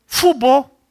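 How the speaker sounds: "futebol" as "fubol", high and loud; American-style pronunciation. Shown as a demonstration. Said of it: "aprendi com a minha netinha".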